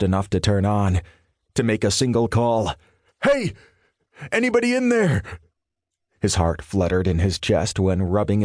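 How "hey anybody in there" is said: shouting. A narrator's reading. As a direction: yell into the building for help. Pounding on door, shouting.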